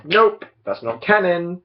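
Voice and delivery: high, loud mock refusal; mock dismissal. Acts out Disney dismissing the books.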